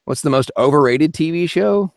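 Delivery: usual speaking voice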